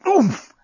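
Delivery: sharp, high oomph of impact; winded gasp. A narrator's reading. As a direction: gasp as if elbowed in the stomach. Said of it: "gasped the journalist".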